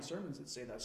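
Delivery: ordinary delivery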